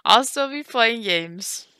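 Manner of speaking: high, loud, laughing words; amused and breaking into laughs; through laughter. Real, not acted. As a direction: struggle to speak while cracking up. Cracking up about gaming at forty.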